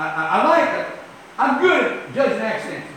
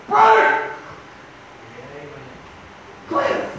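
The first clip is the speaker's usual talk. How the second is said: calling out in surprise; 2 semitones lower, much slower than usual. Acted. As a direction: call out to someone caught in the act, drawn out. Acted surprise encounter at airport.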